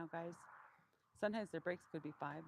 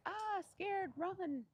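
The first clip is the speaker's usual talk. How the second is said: panicked donkey voice; 13 semitones higher, 4 dB louder, much slower than usual. Retold or imitated. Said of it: "It was always just like".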